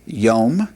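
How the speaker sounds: Hebrew "yom" as one clear syllable; Hebrew pronunciation. Shown as a demonstration. Teacher shows students how Hebrew "yom" is pronounced, one syllable. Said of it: "this would be pronounced".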